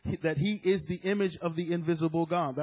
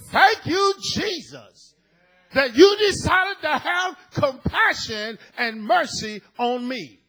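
loud, high shout of praise; joyful shout; 11 semitones higher, 12 dB louder, slower than usual. Acted. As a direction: shout thanks like the whole church. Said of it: "everybody in the house said".

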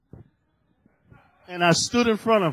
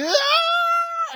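put-on sudden high shrieking baby cry, much louder; imitated baby scream; 7 dB louder than usual. Preacher imitating the baby waking in the crib, sudden shriek. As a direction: shriek like a startled infant.

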